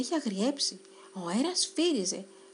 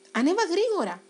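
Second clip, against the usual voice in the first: higher, louder urgent call; shouting; 7 semitones higher, 6 dB louder than usual. Acted. Spider shouting to the mouse to climb on quickly. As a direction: urge him up fast. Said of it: "του φώναξε η αράχνη".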